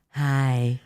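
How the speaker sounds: a man mimicking a woman's voice; fake girly voice. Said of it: "like, trying to be a chick voice".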